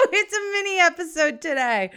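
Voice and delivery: real giggly burst while talking; laughing. Real, not acted. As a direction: laugh your way through it. Laughs at own congested voice.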